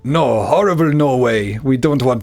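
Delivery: hammed-up French accent, slowing at the end; mock French accent. Gamer voicing the French-born king refusing Norway, in a mock French accent. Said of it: "in my best French accent".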